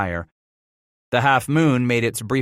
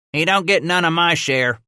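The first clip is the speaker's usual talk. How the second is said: higher, grudging boatman grumble; grumbling; 8 semitones higher, 4 dB louder than usual. Acted. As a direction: grumble over your own share.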